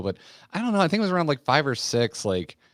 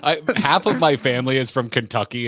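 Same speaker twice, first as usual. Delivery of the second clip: ordinary talk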